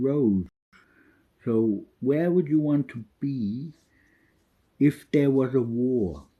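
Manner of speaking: put-on German accent, drawn-out question; German-accented dad. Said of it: "his dad had a German accent still".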